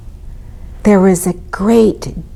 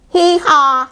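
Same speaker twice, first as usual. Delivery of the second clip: high two-note hee-haw; donkey bray; 9 semitones higher, 3 dB louder than usual. A narrator's reading. Donkey waking the stable, high bray. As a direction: imitate a donkey's loud bray. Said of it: "brayed Donkey".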